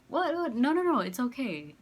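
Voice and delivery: high, loud, flustered protest; retold startled no-no-no; flustered refusal. Hastily refusing his offer to buy.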